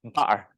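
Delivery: "Bar" as butter with a silent, throat-stopped T; glottal stop. Shown as a demonstration. Teacher repeating her example, "Bar" with glottal T. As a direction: cut "Bar" in the middle with a glottal catch. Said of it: "glottal at the back of my throat".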